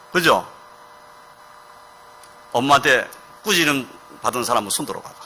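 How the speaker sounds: ordinary delivery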